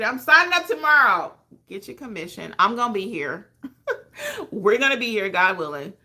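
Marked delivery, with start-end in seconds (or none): 0.0-1.3 loud eager cry; high and loud, ready to sign up now; mock excited vow. Imitating excited viewers, loud eager cry. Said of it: "they get excited". 3.6-4.4 high laugh. Real, not acted.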